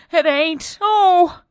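high, loud fearful protest; frightened servant crying out. Acted.

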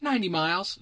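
put-on very high child's voice giving orders; bossy kid. Kid orders Bill to be his horse, high bossy child voice. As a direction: order the grown-up about like a small boy.

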